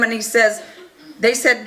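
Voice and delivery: normal talking voice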